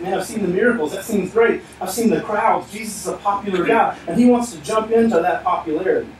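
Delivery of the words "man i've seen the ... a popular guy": higher-pitched eager fan; enthusiastic. Acted. Man who runs up to Jesus having seen the crowds, enthusiastic. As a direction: act out an enthusiastic newcomer. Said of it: "this man runs up enthusiastic".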